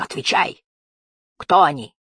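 harsh monster interrogation; thundering demand. A narrator's reading. Giant toad questioning Rinan about strangers, thundering. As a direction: demand an answer as a menacing beast. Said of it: "прогремел Геллик".

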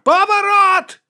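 long, drawn-out high yell; shouting. A narrator's reading. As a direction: scream the order out slowly and loudly. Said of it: "кричав капітан".

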